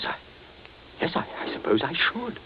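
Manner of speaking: normal talking voice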